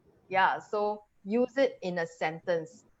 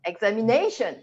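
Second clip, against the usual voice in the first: "examination" with a strong NA, then falling; stress before shen; 2 semitones higher, 4 dB louder than usual. Shown as a demonstration. Teacher echoing the learner, stressed NA in "examination". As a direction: hit NA in "examination". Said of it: "you stress the syllable just before shen".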